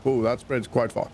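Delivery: ordinary talk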